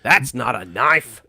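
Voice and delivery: slow broad Australian quote of that's not a knife; hammy Australian film line, slowed right down; Crocodile Dundee quote. Retold or imitated. Aussie guest quoting the famous film line, broad Australian. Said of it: "like, Crocodile Dundee".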